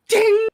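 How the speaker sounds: bell ding imitation.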